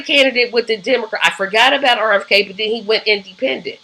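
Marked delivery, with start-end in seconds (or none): none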